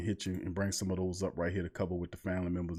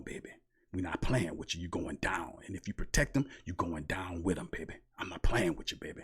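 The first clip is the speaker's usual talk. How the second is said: low, growled-down threat to abusers, a touch softer; Saints voice; 3 semitones lower, 4 dB quieter than usual. Acted. Host warns listeners who hurt children, in a low menacing voice. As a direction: lean in low and menacing, half-warning. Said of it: "I'm talking in my Saints voice".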